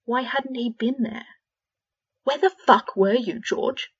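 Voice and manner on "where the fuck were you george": loud raging question; in a rage. A narrator's reading. Fred raging at his twin, loud fury. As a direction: rage at him for not being there. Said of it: "Fred was in a rage now".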